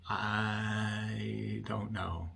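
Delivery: very slow, stretched-out retold denial; drawn-out denial. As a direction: drag the words right out, imitating the wife. Voiced wife feigns or protests ignorance.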